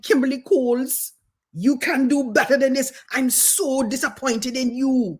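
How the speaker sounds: high Caribbean voice of reproach; Caribbean accent. Retold or imitated. Teacher tells her pupil she is disappointed, Caribbean accent. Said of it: "I'm gonna do an all-purpose Caribbean accent".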